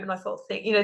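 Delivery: usual speaking voice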